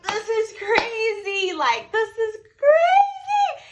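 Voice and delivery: very high, stretched-out squeal; re-enacted thrilled squealing; excited. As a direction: draw it out slowly in high-pitched disbelief. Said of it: "I was so excited".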